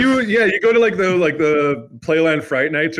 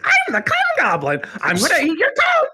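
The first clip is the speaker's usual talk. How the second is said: high squeaky goblin voice; 14 semitones higher than usual. Pretend. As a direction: screech it like a goblin. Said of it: "the Cum Goblin voice".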